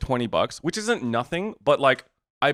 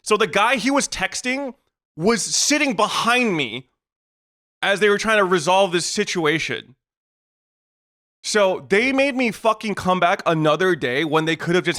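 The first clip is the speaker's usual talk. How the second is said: high, exasperated emphatic rant; indignant rant; 5 semitones higher than usual. Real, not acted. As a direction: stretch the key words in raised, outraged disbelief. Indignant at being made to return.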